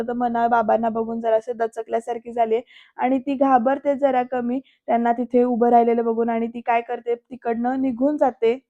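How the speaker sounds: ordinary delivery, nothing marked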